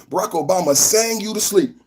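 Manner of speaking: usual speaking voice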